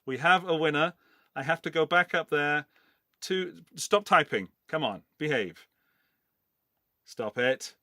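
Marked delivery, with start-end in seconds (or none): none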